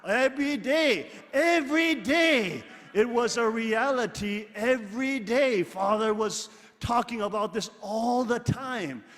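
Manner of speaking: loud and high, hammering each every day; rising loud sermon emphasis; fervent preaching. Real, not acted. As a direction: proclaim it like a revival preacher. Preacher hammering a point home.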